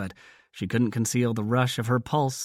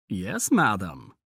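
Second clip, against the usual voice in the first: slow, teasing mock-formal reply; lightly teasing yes madam; 2 semitones lower, slower than usual. A narrator's reading. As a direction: drawl it out slowly to tease her. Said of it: "he said in a teasing voice".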